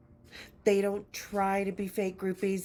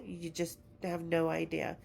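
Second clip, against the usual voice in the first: usual speaking voice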